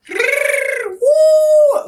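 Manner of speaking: high loud whoop; imitating hyper kid. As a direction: whoop like a kid bouncing off the walls. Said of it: "The kid went".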